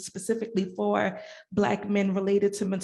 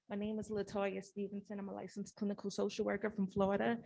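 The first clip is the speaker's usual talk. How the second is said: very soft, barely audible introduction; soft-spoken; 12 dB quieter than usual. Real, not acted. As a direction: say your name almost under your breath. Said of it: "I can barely hear you".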